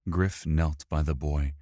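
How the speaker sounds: plain reading voice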